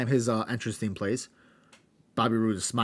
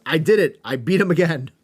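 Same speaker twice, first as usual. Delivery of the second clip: louder, a touch higher, gloating champ; mock gloating cheer; 4 semitones higher, 7 dB louder than usual. Host retelling champ's celebration, louder mock gloat. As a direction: crow it like a winner. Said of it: "celebrates and says".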